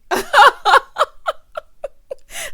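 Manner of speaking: loud, high cackle; real high-pitched belly laugh; big laugh. Real, not acted. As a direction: burst out laughing, loud and long. Cracks up at own joke.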